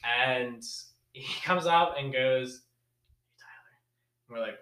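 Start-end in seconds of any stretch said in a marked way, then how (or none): 3.4-3.7 barely audible creepy whisper; very soft imitation of a close talker; imitated close talker. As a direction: whisper it as if right in their face. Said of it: "He was a close talker".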